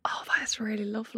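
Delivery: whispered awe; awed whisper. Real, not acted. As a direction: breathe it in wonder.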